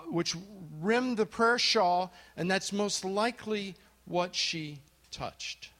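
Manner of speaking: usual speaking voice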